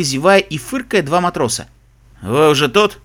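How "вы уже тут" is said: gruff captain's curt question; grumbled. A narrator's reading. Captain finds passengers already waiting at the pier, grumbling. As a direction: grunt it out brusquely. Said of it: "буркнул капитан".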